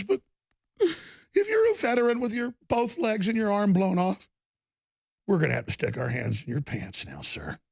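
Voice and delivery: sarcastic mockery. Mocking leftists' hypocrisy.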